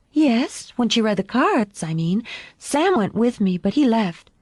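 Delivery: put-on high child's voice explaining herself; earnest little girl. A narrator's reading. Daughter answering her father's questions, earnest and high. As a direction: answer openly, pitched well up.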